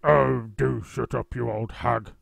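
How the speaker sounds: husband snapping back, slow and loud; Mister Twit grumbling. A narrator's reading. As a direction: brush her off with a growl. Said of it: "Mister Twit said".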